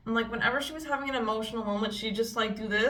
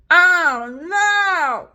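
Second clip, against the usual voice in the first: higher, loud oh no; loud dismayed cry; 6 semitones higher, 10 dB louder than usual. Real, not acted. Vlogger seeing her concealer, crying out in dismay. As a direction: yelp in real dismay.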